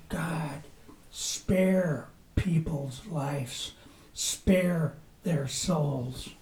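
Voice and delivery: very soft, slow plea; almost breathe it, slowly, as a prayer; hushed prayer. Pretend. Preacher voicing his own prayer for lost souls, hushed and slow.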